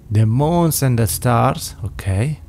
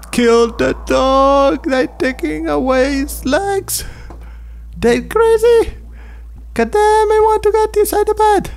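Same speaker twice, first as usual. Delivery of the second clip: panicked squeal. Real, not acted. Player sees toys kill the dog, terrified squeal.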